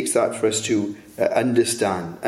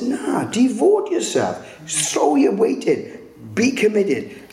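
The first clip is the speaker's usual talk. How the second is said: Father urging commitment; 11 semitones higher, slower than usual. Retold or imitated. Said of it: "Because Father says".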